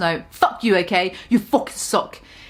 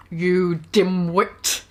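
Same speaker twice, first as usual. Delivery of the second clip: low slow retake; 5 semitones lower, much slower than usual. Shown as a demonstration.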